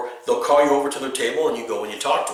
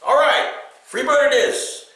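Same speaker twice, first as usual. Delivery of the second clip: much higher and louder, bright and willing; cheerful eager; 10 semitones higher, 3 dB louder than usual. Shown as a demonstration. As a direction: agree happily like it's your favourite request. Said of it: "Don't do that".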